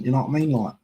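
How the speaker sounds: lightly imitated accent of a known person; a hint of a mimicked accent; slight accent impression, close to own voice; Lee Judge's accent. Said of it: "that was my Lee Judge's a-, accent".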